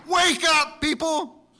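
loud, high rousing call; preacher's cry to wake them. Acted. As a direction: shake the sleeping church awake. Jesus calling dead church.

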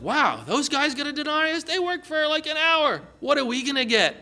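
high, wide-eyed astonishment; amazed anticipation. Acted. Waiting worker overhearing the others' pay, amazed. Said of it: "And you're like".

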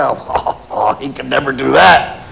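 a congregation brushing off the idea, laughing; scoffing. Retold or imitated. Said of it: "we scoff at that".

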